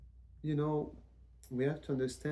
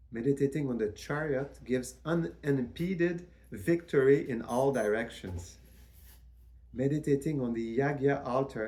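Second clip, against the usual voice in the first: measured recitation; 2 semitones higher, 4 dB louder than usual. Acted. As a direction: intone each blessing like a sacred litany. Recites list of symbol blessings.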